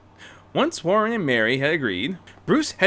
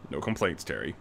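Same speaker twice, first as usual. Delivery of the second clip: put-on low, soft stern old teacher; low quiet old man's rebuke; chiding; 10 semitones lower, 9 dB quieter than usual. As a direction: chide him quietly from deep down. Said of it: "Bruce chided".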